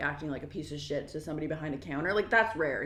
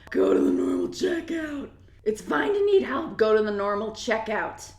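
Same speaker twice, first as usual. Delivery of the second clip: exasperated yelling; 2 semitones higher, 6 dB louder than usual. Real, not acted. Scolding the customer who needed help, exasperated shout. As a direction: yell it with every word stretched.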